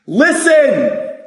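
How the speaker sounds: high ringing call of Listen!. Retold or imitated. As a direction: call Listen! out like Jesus to the crowd. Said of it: "He said".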